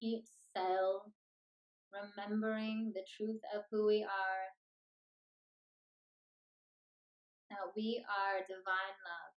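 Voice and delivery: unhurried meditation voice with long pauses; guided meditation. Acted. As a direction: lead them gently into stillness, phrase by phrase.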